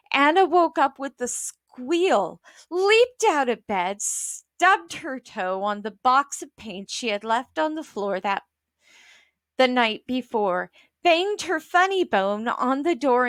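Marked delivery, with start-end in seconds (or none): none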